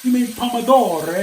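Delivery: a hint of an Italian accent; Italian dude. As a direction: play the Italian guy correcting you. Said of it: "a little Italian dude who said".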